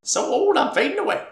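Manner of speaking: put-on high, fading old ghost voice; old prospector ghost. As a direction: let it fade out like a dying spirit. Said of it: "He's that literal ghost".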